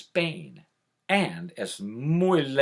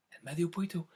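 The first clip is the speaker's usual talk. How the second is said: usual speaking voice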